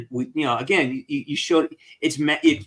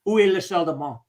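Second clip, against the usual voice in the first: tourist French, second try a bit faster; mock French accent; 4 semitones higher, faster than usual. Host shows off phrasebook French in a mock French accent. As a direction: ask for the bathroom like a proud beginner in French. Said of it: "Yeah, that's pretty good".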